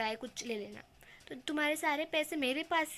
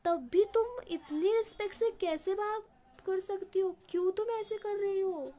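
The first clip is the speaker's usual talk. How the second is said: higher, louder exasperated demand; exasperated anger; 7 semitones higher, 4 dB louder than usual. A narrator's reading. Landlord scolding Julia for her meek respect, exasperated shout. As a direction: shake her into standing up for herself. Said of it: "फिर वो और गुस्से में आ जाता है".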